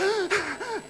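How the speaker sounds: big high gasp, half laughing; gasping for air. Retold or imitated. Swimmer grabbing the lane buoy after his lap, laughing gasp. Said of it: "and I was like".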